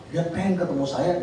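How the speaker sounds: ordinary talk, nothing marked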